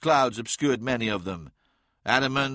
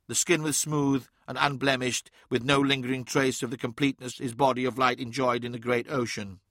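plain reading voice

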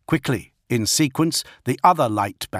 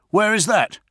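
high, louder demanding shout; roar; 11 semitones higher, 4 dB louder than usual. A narrator's reading. First Master reacting to bolter fire, roaring. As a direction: roar the question out, pitched up. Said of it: "he roared".

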